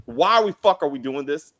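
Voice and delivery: normal talking voice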